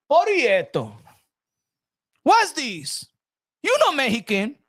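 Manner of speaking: playfully accusing her of lying; mock surprised checking. Acting himself checking her back.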